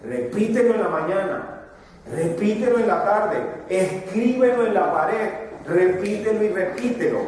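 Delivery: preacher quoting the Lord, insistent; voicing the Lord's command. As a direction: build each repetition like a divine order. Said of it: "donde decía el Señor".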